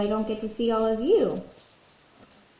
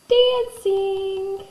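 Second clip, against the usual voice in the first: high squeaky voice; 11 semitones higher, 4 dB louder than usual. Acted. Answers in a high voice.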